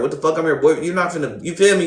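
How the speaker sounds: normal talking voice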